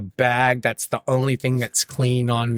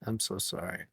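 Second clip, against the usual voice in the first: sheepish apology; 3 semitones lower, 12 dB quieter than usual. Retold or imitated. Sheepish apology to angry seatmate.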